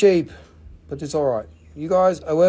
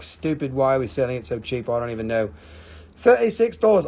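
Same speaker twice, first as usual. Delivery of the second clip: normal talking voice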